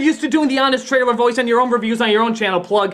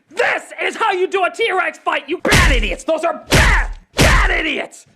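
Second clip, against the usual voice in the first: scolding yell; 6 semitones higher than usual. Acted. The scene scolding the film's makers in a high, furious yell. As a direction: scold them like a furious parent. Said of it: "grabs them by the ear and says".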